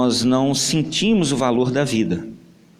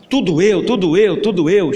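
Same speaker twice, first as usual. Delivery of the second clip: louder repeated whine; sulky complaint; 4 semitones higher, 6 dB louder than usual. Acted. Brother grumbles loudly about always being sent. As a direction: stomp off muttering it's always me.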